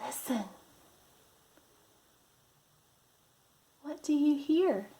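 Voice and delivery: lively, drawn-out picture-book voice; reading to children. Acted. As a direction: read it like a storybook for little ones.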